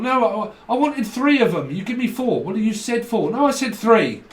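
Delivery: high, loud complaining customer; indignant customer. Acted. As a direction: argue back like a customer who was shortchanged. Acted shop quarrel with customer.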